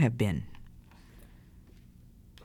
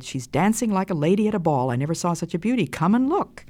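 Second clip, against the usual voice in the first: put-on higher, delighted boy's exclaiming; retold excited child; 5 semitones higher, faster than usual. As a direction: imitate an eager boy, rising high at the end. Retells the excited boy's call.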